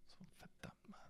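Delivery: very soft, whispered frustration; hushed demo of exasperation; muttered curse. Shown as a demonstration. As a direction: curse under your breath. Acts out reaction to failure.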